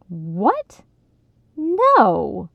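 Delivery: aghast outburst; appalled. A narrator's reading. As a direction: protest as if appalled. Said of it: "Ronnie was appalled".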